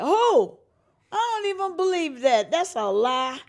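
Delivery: high, loud astonished outburst; disbelieving exclamation. Retold or imitated. Singer reacting to an Opry invitation, loud high disbelief. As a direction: shout it in shock.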